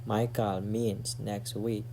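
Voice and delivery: normal talking voice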